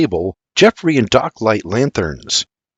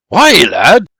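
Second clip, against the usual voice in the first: higher, louder startled questions; Geoffrey's alarmed questioning; 7 semitones higher, 5 dB louder than usual. A narrator's reading. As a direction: ask with quick rising alarm. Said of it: "Geoffrey asks".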